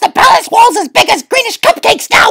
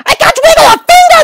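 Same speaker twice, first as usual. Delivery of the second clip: wailed; 6 semitones higher, faster than usual. Acted. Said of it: "the trumpeter wailed".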